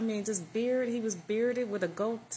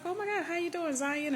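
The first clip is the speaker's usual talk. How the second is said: excited greeting; 9 semitones higher than usual. Retold or imitated. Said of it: "he was like".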